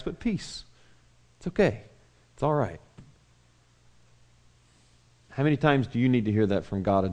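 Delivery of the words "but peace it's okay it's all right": soft reassurance. Acted. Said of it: "And he says".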